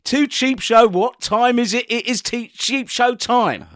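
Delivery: upbeat announcer opening the show; show host intro. Acted. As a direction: welcome the listeners like a host.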